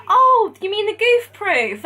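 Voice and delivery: high, slow gentle correction; lightly voiced kind recruiter. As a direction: help her out kindly. Recruiter kindly correcting her.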